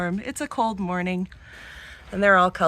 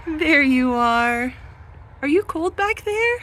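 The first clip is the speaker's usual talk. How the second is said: much higher, cooing question; 6 semitones higher, slower than usual. Real, not acted. Farmer asking a hiding baby rabbit if it is cold, high baby talk. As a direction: coo at the little bunny hiding in back.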